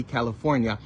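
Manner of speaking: ordinary talk, nothing marked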